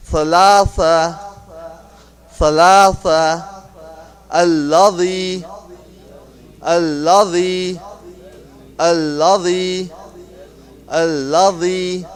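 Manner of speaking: "Thalatha" and "Alladhi" slowly, with th sounds on the tongue edge; pronunciation drill. Shown as a demonstration. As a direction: draw out "Thalatha", then "Alladhi", for repetition. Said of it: "we're gonna practice that".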